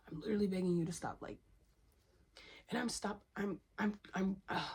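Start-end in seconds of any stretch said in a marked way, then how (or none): 2.7-4.6 stumbling over repeated false starts; stuttering. Real, not acted. Begging viewers to stop and tangling her words, stuttering. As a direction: trip and restart on the same word. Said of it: "I'm stuttering. That's what I'm doing".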